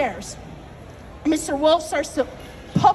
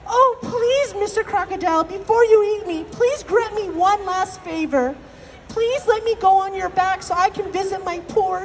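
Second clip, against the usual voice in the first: pleading rabbit; 3 semitones higher than usual. Acted. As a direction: beg for a last favor, piteously. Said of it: "Mister Rabbit goes".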